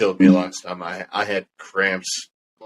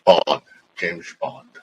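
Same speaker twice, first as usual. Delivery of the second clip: a lightly done Sean Connery; Sean Connery impression; 2 semitones lower, slower than usual. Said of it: "a good Sean Connery impression".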